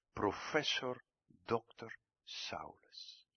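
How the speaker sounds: slow, mock-reverent whisper; preacher whispering Saul's titles with ironic awe. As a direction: savour the grand title under your breath.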